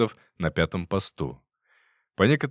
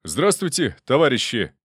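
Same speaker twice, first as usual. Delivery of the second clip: proclaiming; 5 semitones higher, 4 dB louder than usual. A narrator's reading. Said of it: "провозгласил".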